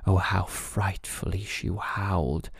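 ordinary delivery

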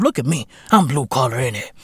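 usual speaking voice

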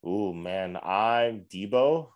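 ordinary talk, nothing marked

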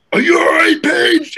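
very high, loud mock shout; hammed-up angry yell; pissed-off coach. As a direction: holler it like a furious coach. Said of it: "he's pissed off".

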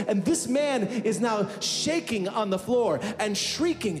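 high, ringing sermon voice building to a climax; preaching over the church music; fiery preaching. Acted. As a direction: preach it out to the congregation. Preaching at full force.

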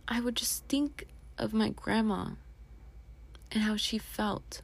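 whisper. Real, not acted. Remembering grandma with covid.

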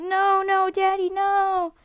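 put-on high, slow pleading child's cry. Son crying as dad fakes being taken, pleading. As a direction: drag out the no like a sobbing kid. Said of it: "I start crying".

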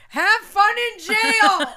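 fed-up caller yelling before hanging up; mock yell. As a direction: fling it as a parting shot. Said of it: "he yelled at me and he goes".

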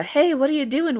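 high, non-accusatory mock greeting; imitated casual check-in. Host contrasting a jealous boyfriend's questioning, imitated casual check-in. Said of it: "not in, like, a ... way".

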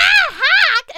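very high, loud squealing call; mocking dub Yona. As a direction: squeal his name like the bad dub. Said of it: "But she's like".

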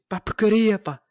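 high, loud exasperated pá, porcaria; mock angry curse. S0 showing how he swears at home: loud mock outburst. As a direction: fake a mild curse in a fit of anger.

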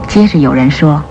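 normal talking voice

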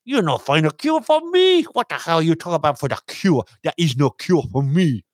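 hammed-up mock accent; mock Chinese accent. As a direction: scoff back at Trump in a put-on accent. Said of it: "you could tell the whole world".

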